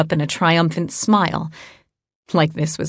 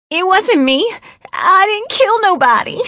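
terrified pleading; 3 dB louder than usual. Acted. Captured woman protests, terrified.

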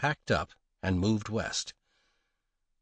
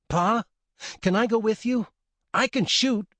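put-on much higher and louder child's voice; a boy pleading to tag along; eager young boy; 12 semitones higher, 4 dB louder than usual. Son begs his pa to take him along, high eager child voice.

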